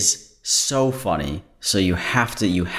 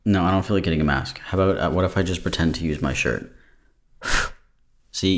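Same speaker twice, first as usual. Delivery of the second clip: ordinary delivery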